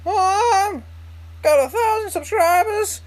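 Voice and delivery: hammed-up kid yelling the news to mom; mock excited yell. Vlogger acting out a squealing call to his mom. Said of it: "No, obviously like that".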